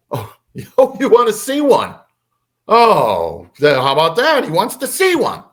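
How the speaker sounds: high, mocking imitation of an angry mother; sarcastic mom. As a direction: jeer with exaggerated mock amazement. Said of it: "she was being sarcastic".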